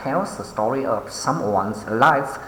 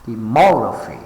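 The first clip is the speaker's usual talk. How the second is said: de-MOG-raphy, leaning on MOG; stress on correct syllable; 3 dB louder, faster than usual. Shown as a demonstration. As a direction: say demography stressing the second syllable. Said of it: "mark the stress on the correct syllable".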